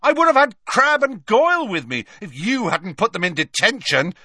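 put-on a boy bragging to the teacher; defiant Malfoy. A narrator's reading. Malfoy refusing Snape's protection, high and defiant.

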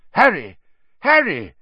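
put-on old wizard crying out for Harry; urgent calling out. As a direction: call his name loud and high, urgent. Dumbledore finds Harry, calls him.

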